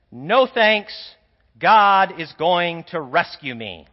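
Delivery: stubborn man refusing rescue from a helicopter, slow and loud. Acted. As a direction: drag out the refusal.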